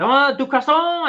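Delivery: put-on high, loud, drawn-out French-accent echo; imitated French native accent. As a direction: mimic a Frenchman's exclaimed correction, French accent. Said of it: "He actually went".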